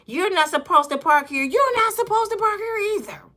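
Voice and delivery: high nagging Karen impression; mock Karen. Karen nagging a driver about parking, shrill-high mock. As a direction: whine it like an entitled Karen.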